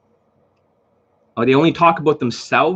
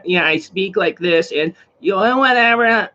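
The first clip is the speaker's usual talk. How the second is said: slow, high-pitched mocking drone; hammed-up imitation of a monotone dating coach; drawn-out put-on voice, climbing higher at the end; Todd Valentine; 7 semitones higher than usual. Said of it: "just like Todd Valentine".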